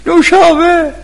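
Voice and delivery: high-pitched shouted food order; imitated calling out. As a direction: mimic someone calling across a graveside.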